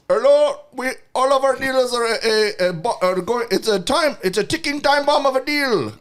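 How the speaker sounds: high, loud fake Middle Eastern accent; bad Middle Eastern accent. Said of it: "a really bad, like, Middle Eastern accent".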